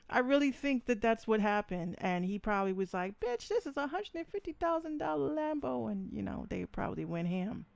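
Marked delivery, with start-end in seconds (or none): 3.2-5.9 mocking voice. Voicing [name] in car.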